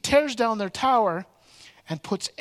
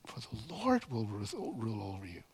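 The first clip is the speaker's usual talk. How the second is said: much softer and lower, slowing; solemn hush; 8 semitones lower, 10 dB quieter than usual. Retold or imitated. Gideon telling the people the Lord will rule, hushed. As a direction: drop to a reverent low hush.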